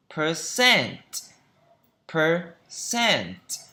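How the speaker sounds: "Percent" with CENT high and falling; careful "Percent", weight on the last syllable; second-syllable stress. Shown as a demonstration. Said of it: "Trọng âm thứ hai".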